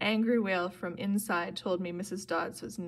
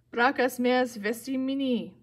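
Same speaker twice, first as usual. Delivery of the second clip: higher battle cry, a little louder; mild yell; 4 semitones higher, 4 dB louder than usual. A narrator's reading. Percy yells Latin at Missus Dodds, mild shout. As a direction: call it out like a half-shouted curse. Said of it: "I yelled".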